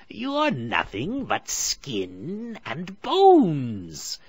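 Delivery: high, very slow, loud sneer; a hag judging her prey; witch voice. A narrator's reading. Witch inspects caged Hansel, slow and sneering. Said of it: "said the witch".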